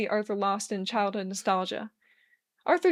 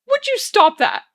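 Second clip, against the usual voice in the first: much higher, louder complaint; indignant protest; 12 semitones higher, 10 dB louder than usual. A narrator's reading. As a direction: protest half laughing. Arthur protesting John's teasing.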